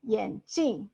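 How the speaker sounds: 眼镜 with 镜 falling sharply; fourth tone on 镜. Shown as a demonstration. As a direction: drop the pitch firmly through 镜. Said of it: "That's eyeglasses".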